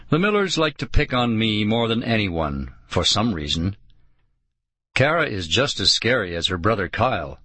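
ordinary delivery

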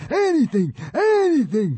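high, desperate squeal; high-pitched grovelling plea; pleading. A narrator's reading. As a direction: beg frantically for mercy. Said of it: "pleaded the consul".

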